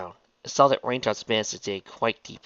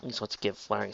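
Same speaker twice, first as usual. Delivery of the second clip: usual speaking voice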